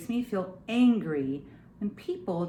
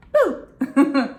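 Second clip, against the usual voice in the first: loud laughing boo; big giggly boo; playful boo; 5 semitones higher, 10 dB louder than usual. Pretend. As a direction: jump out with a boo. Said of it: "I was being silly".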